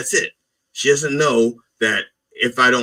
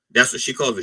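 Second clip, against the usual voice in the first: ordinary talk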